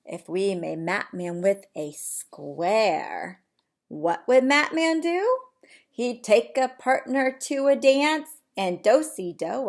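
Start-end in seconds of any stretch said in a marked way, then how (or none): 3.9-9.7 bright, lively storybook reading; animated read-aloud. Acted. Teacher reading to her class, lively storybook voice. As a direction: read it big for the little ones.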